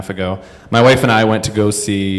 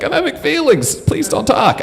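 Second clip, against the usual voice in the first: squeakily high, acted pleading; high-pitched plea. Moviegoer begging a kid not to talk, very high. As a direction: plead far up in a mock falsetto.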